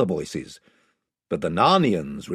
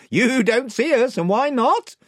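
raised voice of a gang chief; 12 semitones higher, 5 dB louder than usual. A narrator's reading. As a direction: warn them off as spokesman, pitched up. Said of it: "said the chief voice".

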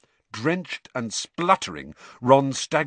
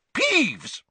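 Peeves! called much higher and sharp; stern teacher yelling Peeves!; angry yell. Acted. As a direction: snap the name up at him. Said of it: "yelled an angry voice".

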